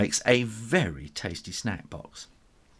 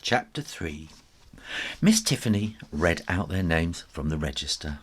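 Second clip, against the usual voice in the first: usual speaking voice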